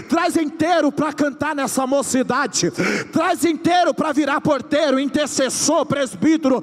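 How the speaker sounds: high, loud prophetic shouting; prophesying. Acted. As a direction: declare it with full force. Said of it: "eu tô profetizando". (profetizando aos gritos)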